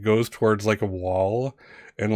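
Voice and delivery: ordinary delivery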